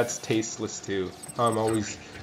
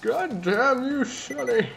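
put-on grumpy old man; 7 semitones higher than usual. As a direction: scold like a cranky grandpa. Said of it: "good old man voice".

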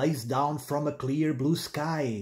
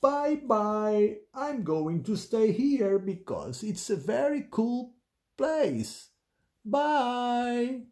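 high storybook pig saying farewell; happy children's-story character, pitched up; cheerful Penelope the pig; 7 semitones higher, slower than usual. Acted. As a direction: sing out the goodbyes in a high voice.

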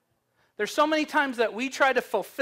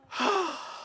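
a high, tired sigh; exasperated sigh; 9 semitones higher, 4 dB quieter than usual. Retold or imitated. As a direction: sigh it out, fed up. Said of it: "And she goes".

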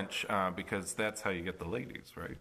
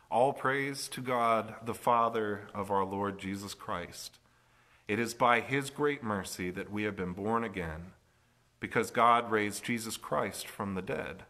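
scripture reading; 4 dB louder than usual. Acted. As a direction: proclaim the verse to the congregation. Reads Scripture aloud.

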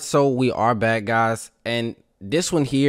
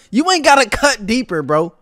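shouting high and loud; hype yell; 12 semitones higher, 9 dB louder than usual. Real, not acted. Streamer yelling at a chatter, loud hype shout.

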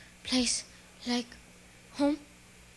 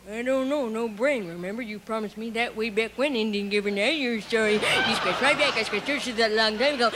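a touch lower, a hint of a dopey scarecrow; lightly voiced Scarecrow; 6 semitones lower than usual. Scarecrow reminds Dorothy of her promise, lightly lower.

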